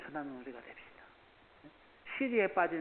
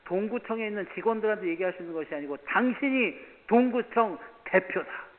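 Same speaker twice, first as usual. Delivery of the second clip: stern retold scolding; 3 semitones higher, 8 dB louder than usual. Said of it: "왜 그런지 얘기를 했어요".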